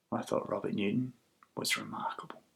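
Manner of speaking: very soft, sinking to a whisper; hushed confiding. Retold or imitated. Girl answering him over tea, hushed to a whisper. Girl's intimate reply in the cafe.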